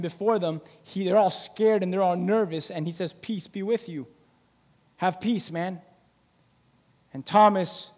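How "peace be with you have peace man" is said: soft, gentle peace; Jesus calming the disciples, soft; calm reassurance. Retold or imitated. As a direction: settle their fear softly.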